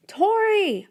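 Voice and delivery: high, loud calling yell. Acted. Boy searching the forest yells for lost friend, high and loud. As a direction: shout her name across the woods. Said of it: "I hear Danny yell".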